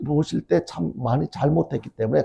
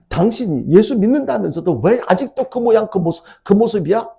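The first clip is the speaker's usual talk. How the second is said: higher-pitched, mildly exasperated scolding; acted reproach; 7 semitones higher, 4 dB louder than usual. Husband reproaching his wife about her faith, acted exasperated reproach. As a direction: imitate a spouse finding fault.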